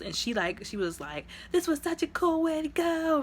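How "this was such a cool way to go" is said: high, bright mock Yelena line; excited Yelena. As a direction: squeal it like a thrilled kid sister.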